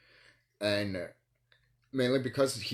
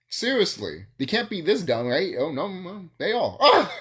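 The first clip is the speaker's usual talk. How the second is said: laughing disbelief; 6 semitones higher, 6 dB louder than usual. Real, not acted. Laughing disbelief at their dumbness.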